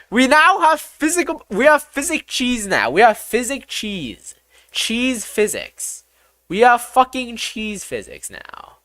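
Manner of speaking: high-pitched, gleeful announcing; excited proclamation. Real, not acted. Celebrates new cheese physics.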